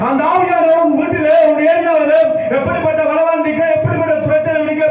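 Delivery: high, unrelenting preacher's chant-like drive; full-force preaching. Acted. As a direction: pile up the phrases with fervour, pitched high. (உச்சக் குரலில் ஆவேசமான பிரசங்கம்)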